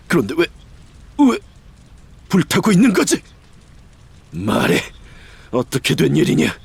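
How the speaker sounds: rising from stunned to outraged; shocked dark lord. Acted. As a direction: disbelief turning to fury. Sees his home burning.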